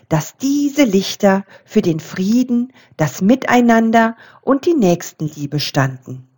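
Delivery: plain reading voice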